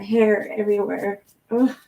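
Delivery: usual speaking voice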